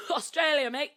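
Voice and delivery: put-on laughing Australian accent. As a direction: chuckle the Aussie cheer. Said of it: "[name]'s Australian dialect".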